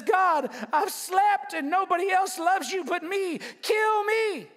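high, whiny self-pitying moan; rising whine up to kill me. Acted. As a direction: Elijah begging God to end it, whining high. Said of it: "self-piteously says".

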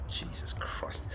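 breathy whispered exasperation; an annoyed whisper at the target's walk; whispered curse. Real, not acted. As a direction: whisper it to yourself in disbelief.

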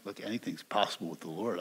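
normal talking voice

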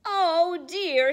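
high, loud dismay; worried mother penguin. Acted. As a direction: sound dismayed. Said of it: "says the mother penguin".

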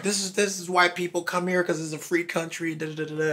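mock patriotic rant. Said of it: "I'm like".

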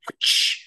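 voiceless whooshing whip noise. Retold or imitated. Player imitates the light whip igniting, sharp whoosh. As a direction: make a rushing hiss like a light whip.

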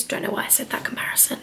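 muttered self-aside; whispered aside. Real, not acted. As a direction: whisper it half to yourself. Aside to herself.

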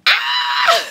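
a high, loud squeal bursting out with a laugh; excited squeal. Real, not acted. Nervous vlogger squeals before her unboxing, high and loud. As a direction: let out a giddy shriek.

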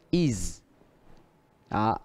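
ordinary delivery

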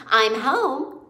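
put-on mother calling to her children. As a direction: call out that you're home. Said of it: "called Missus Frisbee".

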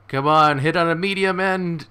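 shouting at the screen for a lucky hit; excited shout. Real, not acted. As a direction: urge the hit on at the top of your voice.

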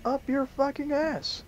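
high, slow, put-on spiteful jab; slow high sneering comeback; retaliating. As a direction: draw it out in a high mocking voice.

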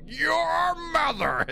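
high, slow mock yell; imitated grandpa yell. Grandpa yelling at his wife during a spat, mock yell. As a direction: bellow it like a grumpy grandpa. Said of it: "my grandpa would go".

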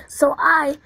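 ordinary delivery

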